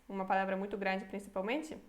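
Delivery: usual speaking voice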